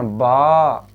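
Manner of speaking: बा held long and open; stretched vowel. Shown as a demonstration. Teacher recalls how they used to say it, बा stretched long. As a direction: draw out the aa in बा. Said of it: "जैसे हम लोग बोलते थे ना".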